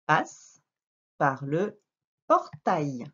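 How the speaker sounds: "passe par le portail" broken by pauses; word-by-word reading. Shown as a demonstration. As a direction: read "passe par le portail" in separate pieces. Teacher reads sentence word by word.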